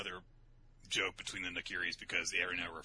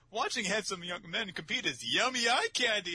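mother's voice; 13 semitones higher, 5 dB louder than usual. Acted. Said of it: "suddenly [name] is a fucking valley girl".

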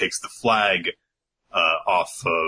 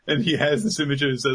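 normal talking voice

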